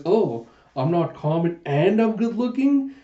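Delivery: a vain bishonen admiring himself; glittering pretty-boy voice. Retold or imitated. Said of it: "doing the Baishonen kind of glittering face".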